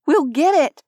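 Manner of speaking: forceful insistence on well; insisting. Acted. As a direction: push the promise hard. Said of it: "I insisted".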